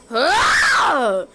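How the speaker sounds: short, loud mock wail; upset yell. Child who lost the tablet cries out, upset yell. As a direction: cry out in pretend upset.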